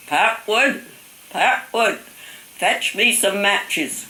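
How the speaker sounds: high, loud summons, slowing right down at the end; grandfather calling out. Retold or imitated. Grandfather in bed summoning the child for matches, loud high call. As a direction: imitate an old man calling a child.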